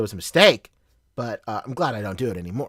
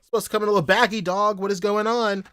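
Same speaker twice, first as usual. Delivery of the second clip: higher-pitched mocking take on mom's complaint; hammed-up mom scoffing at the shirt; mock mom complaining; 8 semitones higher, faster than usual. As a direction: play mom griping about the secondhand shirt, pitched up. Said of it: "she is like".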